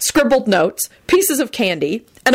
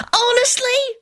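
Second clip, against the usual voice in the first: very high excited squeal; shriek; slower than usual. Acted. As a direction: squeal in disbelief. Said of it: "Tim shrieked".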